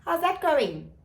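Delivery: high, sly nosy questioning; mock probing. Friend teasing her ex about his family, sly high voice. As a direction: needle him sweetly about his family. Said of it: "she's like".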